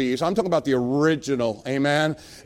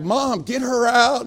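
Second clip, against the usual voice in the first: put-on high, drawn-out teenage plea; pleading with mom; 7 semitones higher, 3 dB louder, slower than usual. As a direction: stretch it out in mock exasperation. Embarrassed teen begging mom.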